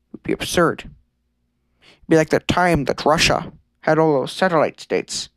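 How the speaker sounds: ordinary delivery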